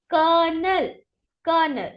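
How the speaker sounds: colonel as "kernel", twice; model for repeating. Shown as a demonstration. Teacher leads students repeating. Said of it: "Repeat after me".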